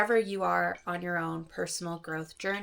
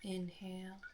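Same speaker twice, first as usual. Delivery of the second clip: soft, unhurried meditation voice with long pauses; soft calm phrases, spaced out by silence; guided meditation; 9 dB quieter than usual. Acted. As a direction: guide listeners gently through each breath. Leads a guided breathing meditation.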